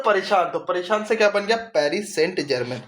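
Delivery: usual speaking voice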